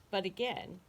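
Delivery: usual speaking voice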